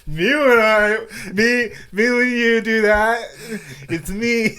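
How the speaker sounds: high, slow mocking whine; mocking himself. Friend mimics himself scratching his nose on camera, high slow whine. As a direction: mimic your own stammering meme line, up high and drawn out. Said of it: "I'm just like".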